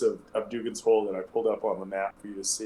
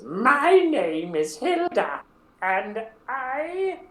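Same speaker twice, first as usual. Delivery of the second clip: put-on much higher, slow, strange old woman voice; Hilda's terrible voice; 13 semitones higher, 5 dB louder, much slower than usual. Hilda introducing herself to the adventurers, high terrible voice. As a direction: drag it out like a gnarled old widow. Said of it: "their voices are terrible".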